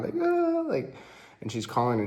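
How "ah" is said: very high mock cry; imitated crying wail. Girlfriend crying while calling her dad, high mock wail. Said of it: "like, crying".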